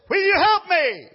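high, loud frantic begging; man losing his grip cries for help; desperate plea. Acted. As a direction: plead like you're slipping. Dangling man pleads.

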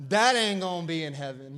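lightly mimicking disapproving churchgoers; religious folk. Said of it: "You religious folk".

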